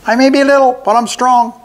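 high, loud squeaky goblin; a pipsqueak goblin refusing; little goblin voice. A narrator's reading. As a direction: play the small goblin, high and defiant. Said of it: "said the goblin".

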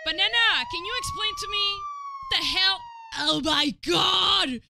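loud, high disbelief; excited shouting. Real, not acted. Streamer seeing Banana on the cover, excited shout. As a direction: yell it out in shock.

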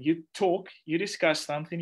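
ordinary talk

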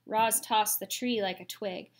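ordinary delivery, nothing marked

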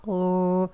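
a weak, drawn-out 우 with rounded lips; soft oo sound. Shown as a demonstration. Teacher demonstrating a soft 우 sound. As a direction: breathe a gentle 우 before the r. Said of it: "우의 약한 소리를 내면서".

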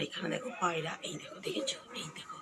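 soft whisper so the pigeons stay. Real, not acted. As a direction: breathe the words, barely voiced. Showing pigeons quietly, not startling them.

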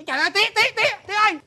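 high, loud cries to father; urgent calling. Acted. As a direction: cry out on finding your dad. Son finds father, calls out.

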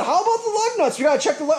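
high, loud, exaggerated urging; insistent retold question. Buyer asking seller to check lug nuts, insistent.

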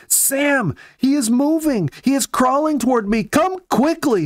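high, thrilled calling out to her husband; excited cry. A narrator's reading. Jenny calls Sam to see the injured chipmunk moving, thrilled high cry. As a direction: cry out in joyful disbelief, voice way up high. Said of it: "she cried".

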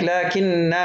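لكن said "laakinnaa", long ending; waqf, alef kept. Shown as a demonstration. Teacher showing the stopped form, long alef on لكن. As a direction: hold a final "aa" on لكن. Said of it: "وقفًا بحط الألف".

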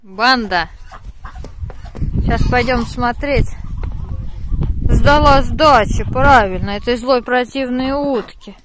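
loud call to the animals. Real, not acted. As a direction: call the gang over loudly. Calling out to the animals.

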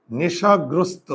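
নেশাগ্রস্ত said slowly, each syllable drawn out; slow spelling demo. Shown as a demonstration. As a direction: stretch out নেশাগ্রস্ত for the class to hear. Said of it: "আমি যদি এখন বলি". (নেশাগ্রস্ত ধীরে, প্রতিটি অক্ষর টেনে)

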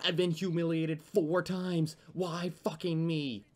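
drawn-out whiny despair; whiny complaint. Real, not acted. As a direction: moan it out in self-pity. Complaining after repeated humiliation.

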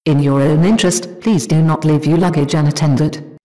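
loud echoing PA announcement. Shown as a demonstration. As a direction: boom it through a hall PA. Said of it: "I will change the settings for reverb".

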